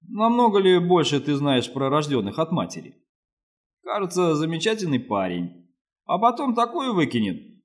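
usual speaking voice

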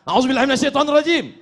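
fast, high, blurted Arabic formula; angry recitation. Shown as a demonstration. Preacher seeking refuge while angry, rapid heated burst. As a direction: fire it off in a temper. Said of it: "Kalau sedang marah".

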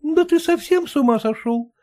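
high exasperated wife voice. Acted. Wife exasperated with husband, high voice. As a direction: throw up your hands as the wife. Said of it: "всплеснула руками жена".

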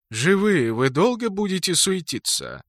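high lilting grave voice; singsong drawl. Acted. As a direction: sing the question lazily. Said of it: "с напевом спросил Смертус".

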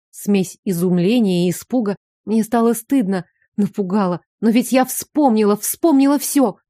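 plain reading voice

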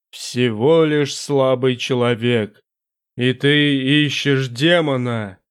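low, slow, echoing demon voice; a vast presence speaking from the dark; booming demon voice. Acted.

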